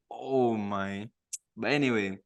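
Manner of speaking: ordinary delivery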